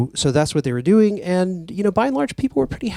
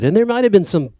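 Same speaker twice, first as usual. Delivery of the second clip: ordinary talk, nothing marked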